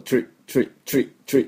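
チュリ as one quick "chree" syllable; short repeated "tree" bursts for チュリ, no vowel after the t; English "tri" sound. Shown as a demonstration. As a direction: say チュリ with t and r fused into "chr". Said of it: "ポイントはですね、 ティーアールワイのこう".

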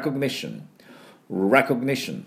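recognition with strong REC and a barely touched o; English model pronunciation. Shown as a demonstration. Teacher showing how it should sound, recognition in English pronunciation. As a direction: hit the start of recognition, weaken the middle. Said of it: "こんな感じになります".

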